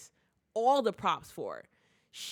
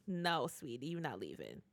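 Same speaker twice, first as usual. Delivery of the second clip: soft, much quieter mock-sweet threat; menacing softness; 6 semitones lower, 8 dB quieter than usual. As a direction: drop to a quiet menacing coo. Lestat threatening Claudia.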